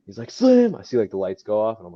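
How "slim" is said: high, loud call across the arena; yelling a buddy's nickname from the crowd. Retold or imitated. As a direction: shout his name to get his attention. Friend calls from front row.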